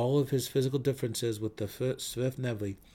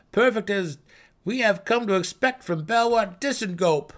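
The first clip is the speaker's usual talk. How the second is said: high, loud cheerful praise; 10 semitones higher, 8 dB louder than usual. A narrator's reading. Gnome praising his peer.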